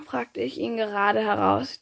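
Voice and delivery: ordinary delivery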